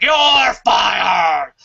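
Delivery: barked firing order, loud; angry boss shout. Retold or imitated. Boss yelling at a writer, loud angry shout. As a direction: play the boss firing someone on the spot.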